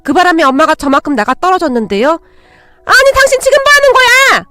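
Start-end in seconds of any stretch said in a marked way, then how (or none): 2.1-4.5 slow, high, loud shriek of disbelief; shocked outburst. A narrator's reading. Mother knocked down by her in-law, shocked shriek. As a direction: screech in stunned outrage, drawn out. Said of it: "친정엄마가 놀라서 눈만 동그랗게".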